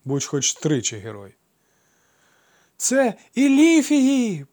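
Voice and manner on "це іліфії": very high, loud, slow screech; piercing shriek. A narrator's reading. Woman in the street shrieking at the crowd. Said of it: "чийсь пронизливий вереск".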